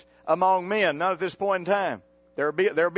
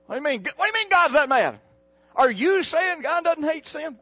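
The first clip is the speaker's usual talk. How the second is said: indignant objection; 8 semitones higher, 4 dB louder than usual. Retold or imitated. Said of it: "acting indignant religious callers".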